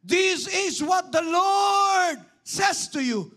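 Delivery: drawn-out, high preacher's shout; booming proclamation. Acted. Prophet declaring God's word to the congregation, booming shout. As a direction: thunder it out slowly at full force.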